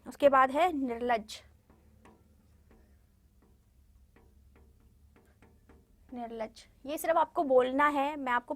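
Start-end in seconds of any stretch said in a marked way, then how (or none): 0.7-6.6 निर्लज्ज with र before ल and doubled ज; pronunciation demo. Shown as a demonstration. Teacher models निर्लज्ज for students. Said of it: "किस तरीके से उच्चारण किया जाता है".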